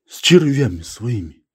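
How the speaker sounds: understated irritated dismissal. A narrator's reading. Ivan Gavrilovich finishing his rebuff about worms, mildly irritated. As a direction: dismiss him with a touch of annoyance. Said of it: "раздражённо отрезал".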